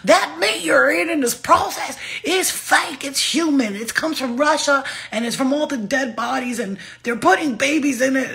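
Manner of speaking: hammed-up alarmist vegan preaching; preachy vegan. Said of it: "They be out here talking about".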